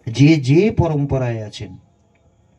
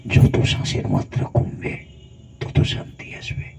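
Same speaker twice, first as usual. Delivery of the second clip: calm guided whisper; slow breathing whisper; 3 dB quieter than usual. Shown as a demonstration. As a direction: whisper it softly as the breath slows. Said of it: "আস্তে আস্তে".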